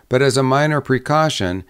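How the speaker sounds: usual speaking voice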